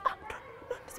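whispered, frightened no no; scared soldier backing away from the giant; scared refusal. Acted. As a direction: shrink back and whisper your refusal.

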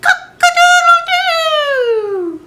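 a rooster waking the farm; rooster crow. Acted. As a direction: crow like a proud rooster at dawn. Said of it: "the rooster crowed".

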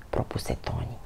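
plain reading voice

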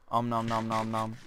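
om nom chewing noises; munch aloud like a cartoon eater; eating sound effects. Shown as a demonstration. Making eating sound effects for the viewers. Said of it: "Eating sound effects".

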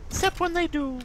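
very high, drawn-out mock heroine cry; Mercy. Gamer mimicking a game healer, hammed-up high voice. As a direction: put on a silly squeaky battle-cry voice. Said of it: "You like my impression of Mercy?".

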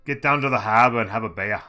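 hammed-up Australian accent, loud; Aussie accent. Game streamer practicing his Aussie on harbor and beer, Australian accent. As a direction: put on a big amateur Aussie accent. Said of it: "I've been working on my Aussie".

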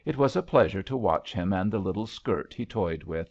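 ordinary delivery

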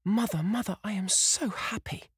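put-on high, bright young girl's voice; a daughter bursting with happiness; high breathless girl, overjoyed; girl's joyful cry. A narrator's reading. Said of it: "whispered the girl".

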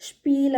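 ഷ്പീൽ, sh instead of s before p; German sp as shp in ഷ്പീൽ. Shown as a demonstration. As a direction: say ഷ്പീൽ with sh, not s. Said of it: "നമ്മൾ pronunciation ചെയ്യുന്നത്".

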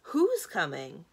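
loud, drawn-out eager question; keen to hear the answer. A narrator's reading. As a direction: ask it eagerly, slow and loud. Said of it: "said Aramin eagerly".

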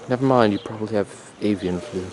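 usual speaking voice

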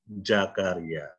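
Jakaria said with a J where Z belongs; Indonesian J for Z. Shown as a demonstration. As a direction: say Jakaria, turning the Z into J. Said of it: "orang Indonesia bacanya apa?". (Jakaria diucapkan dengan J bukan Z)

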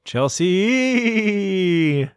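slow, high, drawn-out ghost moan; ghostly wail. Acted. Echoing donor name spookily.

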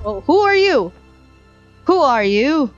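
sudden high startled question. Real, not acted. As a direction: jump at the stranger, alarmed. Startled by strangers appearing.

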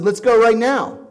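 loud, high call to fight; loud challenge. Retold or imitated. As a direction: bark it out as a bluff to fight. Stands up, challenges the guy.

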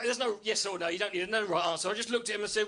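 normal talking voice

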